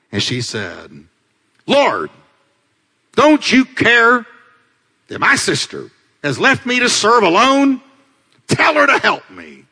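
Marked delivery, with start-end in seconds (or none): 1.6-9.7 acted-out indignant appeal; exasperated Martha complaint. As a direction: demand help in a raised, indignant voice. Acts out angry Martha complaining.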